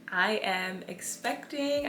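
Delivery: usual speaking voice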